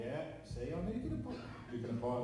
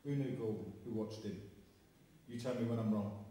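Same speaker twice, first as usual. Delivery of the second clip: ordinary talk, nothing marked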